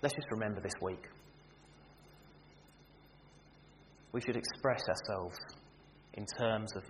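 soft, hushed closing words; gentle closing. Acted. Preacher ending the morning sermon, softly and tenderly. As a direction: settle them into a quiet benediction.

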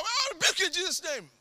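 urgent high-pitched cry; shouted rebuke. Retold or imitated. Repeats his rebuke at the robbers.

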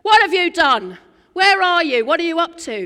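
loud, accusing version God does not use; accusing shout. Retold or imitated. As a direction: bellow the questions like a cross parent. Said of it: "That's not what God says".

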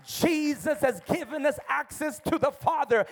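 ordinary talk

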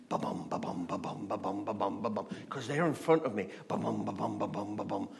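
thump it out like a racing heart; heartbeat. Retold or imitated. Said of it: "my heart started to beat".

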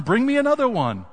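high, loud call to a son. A narrator's reading. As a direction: call out for the next one. Widow calls to her son.

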